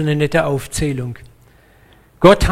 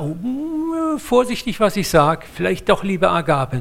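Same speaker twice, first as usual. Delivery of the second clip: vorsichtig abwägend; 5 semitones higher than usual. Acted. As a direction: hem and haw in a higher voice. Imagines old Petrus hesitating, hedging. (zögerlich, mit hohem „mm“)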